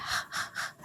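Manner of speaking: a soft airy h, h; breathy h sound demo. Shown as a demonstration. Teacher voicing the R as h, breathy h. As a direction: breathe out h like in hospital. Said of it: "So it's like h".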